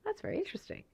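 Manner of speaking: curious. Retold or imitated. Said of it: "I was like".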